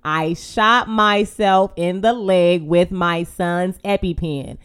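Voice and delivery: very slow word-by-word statement; slow, deliberate. Retold or imitated. Wife repeating it for the emergency operator, slow and deliberate. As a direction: spell it out slowly. Spelling it out to confused dispatcher.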